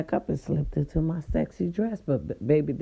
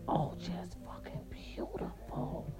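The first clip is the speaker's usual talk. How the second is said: slow, very soft whisper; awed whisper; 14 dB quieter, slower than usual. Real, not acted. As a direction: whisper it slowly, swept away by the memory. Savouring the beautiful spot.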